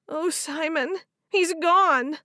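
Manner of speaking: high and breaking with grief; tearful grief. A narrator's reading. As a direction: sob it into his arms. Crying in husband's arms.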